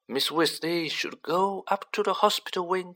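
high, firm instruction; brisk authority. Acted. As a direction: take charge of the child. Adult orders her to hospital.

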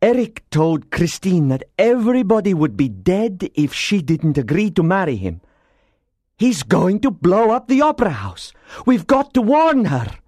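high, mounting alarm; crying out. A narrator's reading. Persian grasps the gunpowder plot, mounting alarm. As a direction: build from realisation to urgent cry. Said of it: "the Persian cried".

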